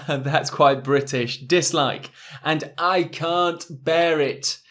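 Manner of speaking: normal talking voice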